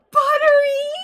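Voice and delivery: high, loud delighted cry of the name; shrieking a viewer's name with joy; excited squeal. Real, not acted. Greeting a new follower on stream.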